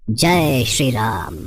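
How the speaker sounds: slow, drawn-out low hail; devotional proclamation. Acted. Hanuman revealing himself before Ram, slow devotional hail. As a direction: stretch each word reverently.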